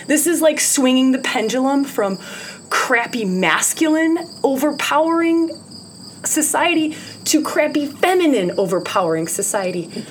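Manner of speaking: ordinary talk, nothing marked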